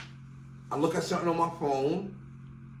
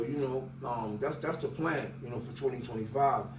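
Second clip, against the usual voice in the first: ordinary talk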